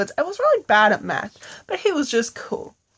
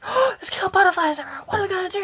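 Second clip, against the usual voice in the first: high, fast, frantic; hammed-up high panic; panicked kids; 8 semitones higher, much faster than usual. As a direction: panic as they swarm you. Kids panic among butterflies.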